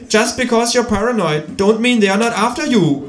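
high, English quote; kangaroo voice. A narrator's reading. Kangaroo quoting to the narrator, high and knowing. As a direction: toss off the lyric knowingly.

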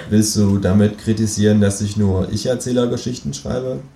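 ordinary talk, nothing marked

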